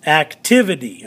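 "Activity" with the second syllable punched; stress on TIV. Shown as a demonstration. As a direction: hit TIV in "Activity", falling away after. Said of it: "of the syllable is activity".

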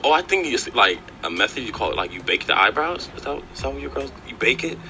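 ordinary talk, nothing marked

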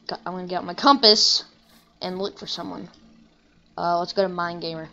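normal talking voice

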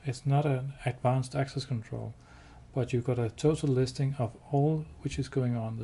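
ordinary delivery

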